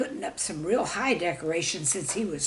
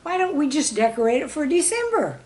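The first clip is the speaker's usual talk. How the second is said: put-on a bright, eager kid's voice, raised and louder; eager student; 7 semitones higher, 4 dB louder than usual. A narrator's reading. Student pitching an idea to the class, eager and raised. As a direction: pitch it up like a keen pupil with an idea. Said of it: "One student said".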